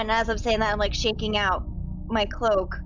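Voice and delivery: ordinary talk, nothing marked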